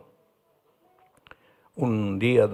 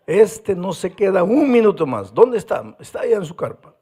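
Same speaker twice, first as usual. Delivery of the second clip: loud, raised voice at the troublemaker; angry outburst; 5 semitones higher, 6 dB louder than usual. Retold or imitated. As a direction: demand it with rising anger. Angry pastor hunting the boy.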